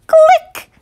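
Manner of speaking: high bright camera click. Acted. Reader makes the camera's click sound. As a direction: chirp the click like a tiny shutter.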